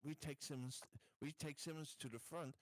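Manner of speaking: ordinary delivery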